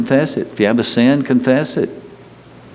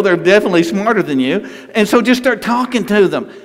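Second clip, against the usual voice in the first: normal talking voice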